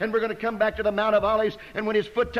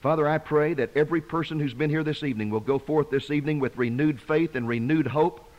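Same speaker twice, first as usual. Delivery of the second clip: low, earnest pulpit prayer; closing prayer; 7 semitones lower than usual. Acted. Preacher prays for everyone here this evening, low and earnest. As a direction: pray over the people, low and unhurried.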